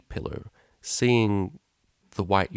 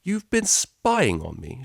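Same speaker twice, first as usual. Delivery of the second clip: slow, louder indignant accusation; exclaimed; 5 semitones higher, 4 dB louder, slower than usual. Acted. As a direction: accuse her, shocked and angry. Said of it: "she exclaimed".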